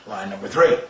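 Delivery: ordinary delivery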